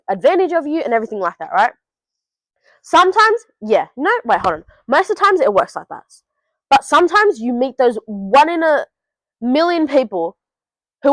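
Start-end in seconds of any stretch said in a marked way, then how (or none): none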